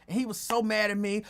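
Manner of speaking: usual speaking voice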